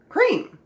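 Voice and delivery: "Cream" high and loud with long E; correct reading. Shown as a demonstration. Teacher confirms the right reading "Cream". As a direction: announce "Cream" brightly. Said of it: "Is it cream or cram or crame".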